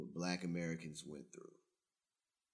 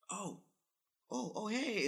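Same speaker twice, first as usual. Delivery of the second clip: surprised oh; 13 semitones higher, 3 dB louder than usual. Retold or imitated. Retelling how a South African man greeted him once he learned he was American, in a high surprised voice. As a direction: pitch jumps way up in sudden recognition.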